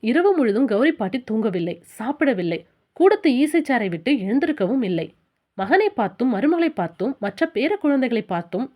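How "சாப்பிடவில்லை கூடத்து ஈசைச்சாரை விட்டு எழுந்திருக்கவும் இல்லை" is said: ordinary delivery, nothing marked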